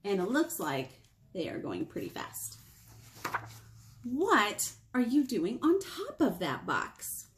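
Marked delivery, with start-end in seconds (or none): none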